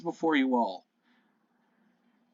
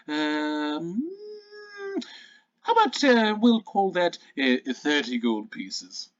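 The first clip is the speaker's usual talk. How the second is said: haggling shopkeeper; 3 semitones higher than usual. Acted. Bertha haggles over the price.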